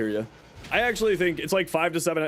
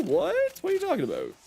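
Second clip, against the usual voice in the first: surprised. Real, not acted. Baffled reaction to chat message.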